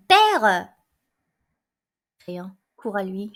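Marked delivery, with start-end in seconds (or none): 0.1-0.7 a loud, urgent call to his father; crying out. A narrator's reading. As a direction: burst in calling for father. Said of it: "Aimant entre en criant".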